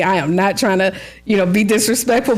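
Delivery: ordinary talk, nothing marked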